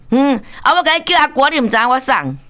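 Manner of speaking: high-pitched phone comeback, played up; mock indignant retort. Aunt answering the caller who claims to know her, mock indignant. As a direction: retort high and insistent, as if put out.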